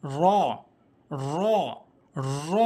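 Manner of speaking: র as a trilled "raw", tongue tip turned up; rolled heavy r. Shown as a demonstration. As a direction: curl the tongue tip to the palate and roll র. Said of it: "জিহ্বার আগাটা উল্টিয়ে".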